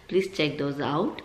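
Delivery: ordinary delivery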